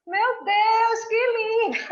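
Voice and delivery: high, loud, laughing near tears; moved exclamation. Retold or imitated. Guest quoting her tearful delight, high and loud with laughter.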